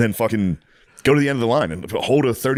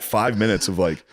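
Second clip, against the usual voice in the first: ordinary talk, nothing marked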